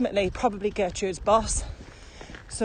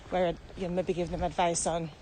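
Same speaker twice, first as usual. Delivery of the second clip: ordinary talk, nothing marked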